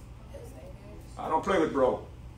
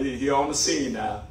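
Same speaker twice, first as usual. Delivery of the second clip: ordinary talk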